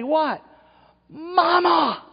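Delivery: put-on high, loud child's cry for mama; lost child calling. Preacher acting a child lost in the mall, high calling out. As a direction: call out like a lost kid spotting mom. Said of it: "you say".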